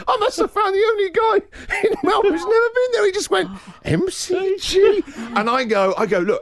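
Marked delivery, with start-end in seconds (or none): 0.1-5.0 imitating the man. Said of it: "he goes like this".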